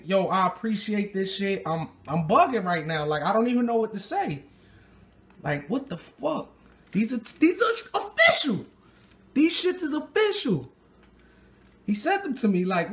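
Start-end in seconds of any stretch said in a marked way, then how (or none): 5.4-10.7 higher, laughing with delight; real excitement over a gift; excited. Real, not acted. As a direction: break into a laugh, thrilled. Unboxing gift sneakers from a viewer.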